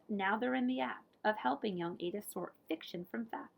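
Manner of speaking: ordinary delivery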